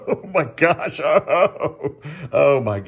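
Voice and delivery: high, loud laughing "oh my gosh"; laughing delight. Real, not acted. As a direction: burst out laughing with joy. Overjoyed by the pizza dough.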